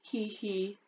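soft sneaky giggle; quiet snicker. Acted. Dragon snickering.

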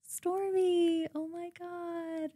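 very quiet, slow influencer voice; hushed vlogger greeting, drawn out; Kylie Jenner. Shown as a demonstration. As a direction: speak softly and slowly like a reality star. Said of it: "Kylie Jenner".

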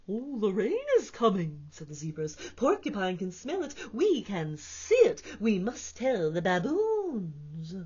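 lightly voiced eager zebras. Zebras character voice.

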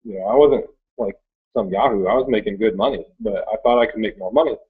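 ordinary delivery

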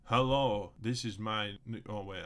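ordinary talk, nothing marked

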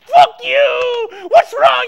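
very loud sudden blow-up; explosive angry shout. Acted. Snapping over a mispronounced name, angry shout. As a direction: explode at full volume.

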